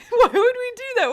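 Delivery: high, laughing disbelief; why on earth, squeaked; incredulous. Real, not acted. Laughing at game debuff.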